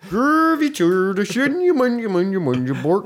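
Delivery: high mock Swedish gibberish; Swedish Chef. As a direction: babble like the Muppet cook. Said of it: "shout outs to my boy, the Swedish chef".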